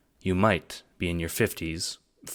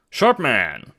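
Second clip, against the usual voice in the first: louder, eager praise; eagerly; 6 semitones higher, 6 dB louder than usual. Acted. Jacob praising John, eager. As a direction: pounce on it gladly. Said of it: "Jacob says eagerly".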